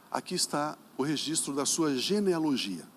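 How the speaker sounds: ordinary talk, nothing marked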